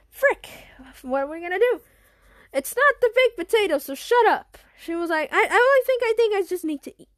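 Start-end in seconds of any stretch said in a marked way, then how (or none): 0.1-1.8 frustrated exclamation. Acted. 2.5-4.6 angry retort. Acted. Kid snaps back at sister. 5.3-7.1 flustered defensive. Acted. Naomi defends herself, flustered.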